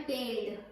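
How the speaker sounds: पेल्ड in one syllable, l straight into d; demo pronunciation. Shown as a demonstration. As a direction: read पेल्ड as a single clipped syllable. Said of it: "ऐसे पढ़ते हैं".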